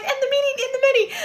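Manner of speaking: very high, thin mock voice, repeating; mock squeaky voice. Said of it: "I'm just like".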